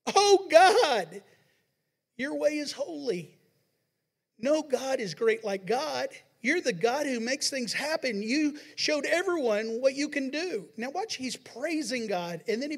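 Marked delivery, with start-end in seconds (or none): 0.1-10.7 high, loud cry of praise to God; exclamation of praise. Shown as a demonstration. Preacher voicing the psalmist praising God, loud and high. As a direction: lift the voice in praise. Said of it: "that's with an exclamation point".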